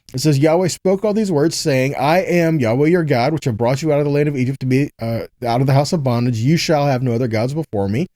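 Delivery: usual speaking voice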